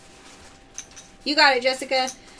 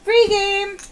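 loud, higher announcement; host announcing a free game; excited callout; 8 semitones higher, 8 dB louder than usual. Real, not acted. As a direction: call out the win brightly. Calling out prize results.

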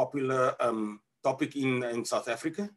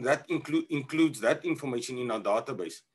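ordinary talk, nothing marked

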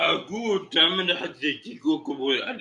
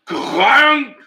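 laughing outburst; 11 semitones higher, 9 dB louder than usual. Real, not acted. Bursts out at the joke.

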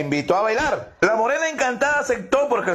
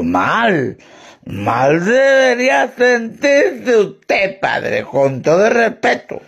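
put-on slow, slurred drunk retort; drunkard; 6 dB louder, much slower than usual. Drunk turns the remark back on the priest, slurring. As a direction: stretch the words out drunkenly. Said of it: "Dice el borracho".

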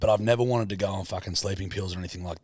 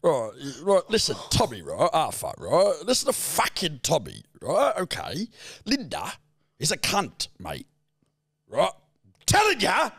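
Alfie impression again; 4 semitones higher than usual. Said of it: "Do it again".

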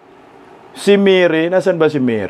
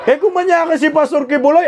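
ordinary talk

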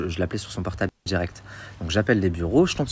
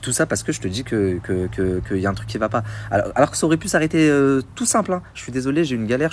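ordinary talk

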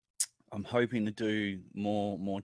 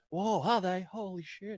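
amazed crowd; 9 semitones higher than usual. Retold or imitated.

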